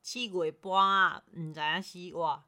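slow, careful Taiwanese Hokkien attempt. Shown as a demonstration. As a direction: learner repeating in Taiwanese Hokkien. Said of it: "再一次".

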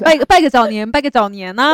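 loud, bright early new year greeting; cheerful new year wishes. Real, not acted. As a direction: call out the greeting happily.

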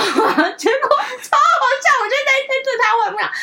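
high, loud words through laughter; laughing while talking. Real, not acted. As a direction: crack up telling the story. Cracking up at own story. (边大笑边说)

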